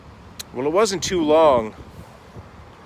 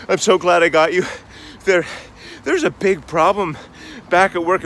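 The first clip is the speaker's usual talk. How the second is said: out of breath; 5 semitones higher, 6 dB louder than usual. Retold or imitated. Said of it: "he's panting, he's out of breath".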